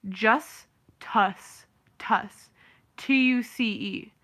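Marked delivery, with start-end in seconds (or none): 0.1-2.5 "Just Tuss" as two words, second rhyming with fuss; spelling pronunciation. Shown as a demonstration. Showing how the family's spelling reads, spelling pronunciation. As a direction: split it into "Just" and a stressed "Tuss". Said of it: "You know how they spelt it?".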